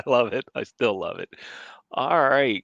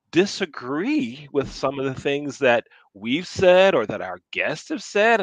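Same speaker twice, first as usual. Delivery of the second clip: ordinary talk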